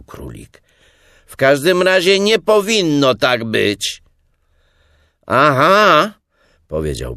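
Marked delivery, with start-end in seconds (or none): 1.3-4.0 loud, high put-on answer; high, raised false voice; disguised voice. A narrator's reading. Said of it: "tym razem już innym głosem". 5.3-6.1 loud, high drawn-out acknowledgement; high, loud response; calling out. A narrator's reading. Pooh still calling into burrow.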